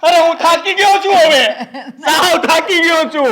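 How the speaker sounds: exhausted breakdown. Acted.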